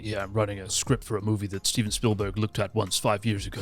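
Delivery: a hint of a smug screenwriter brag; mock LA hustler. Mocking an LA hopeful pitching his script. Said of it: "everyone I spoke to was like".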